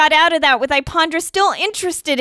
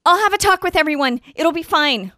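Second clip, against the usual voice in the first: usual speaking voice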